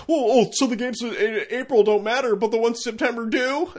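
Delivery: high, loud sneering fan voice; mocking a dumb fan. Mocking fans who say April games don't matter, high sneering whine. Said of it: "I just wish people would look at things".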